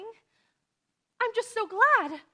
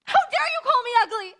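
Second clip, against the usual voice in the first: louder and higher, near tears with rage; furious outburst; 6 semitones higher, 5 dB louder than usual. Acted. Orphan girl lashes out at the insulting neighbour, tearful fury. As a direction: hurl it back at her, choking with hurt.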